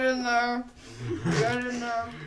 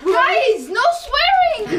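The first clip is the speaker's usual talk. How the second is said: loud slow scolding; 10 semitones higher, 6 dB louder, much slower than usual. Acted. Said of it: "repeats warning louder, slower".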